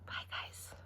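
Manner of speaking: soft whispered sign-off; whispered goodbye. Real, not acted. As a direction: whisper bye to the listeners. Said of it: "signing off".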